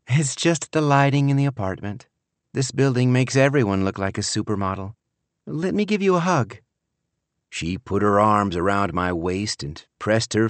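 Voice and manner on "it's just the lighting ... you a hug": higher-pitched daughter, brushing off praise; higher, affectionate daughter character; daughter's light-hearted voice. Acted. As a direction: play the grown daughter in a higher voice.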